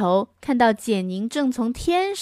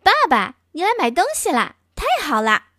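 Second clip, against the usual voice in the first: put-on higher, fast happy girl voice; higher, quicker girl's delight; cheerful little girl; 6 semitones higher, faster than usual. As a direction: chirp it to her daddy. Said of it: "简宁看着爸爸说道".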